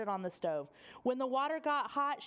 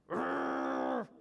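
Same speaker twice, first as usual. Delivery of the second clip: put-on deep throaty growl of a sulky boy; low growl. Horace growls at his mother, deep and grumpy. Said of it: "And Horace growled".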